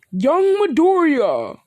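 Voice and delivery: slow, high, loud hero call; booming scream. Acted. All Might calling out to Midoriya in Tartarus, slow booming scream. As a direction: bellow the name across the prison. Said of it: "he would scream".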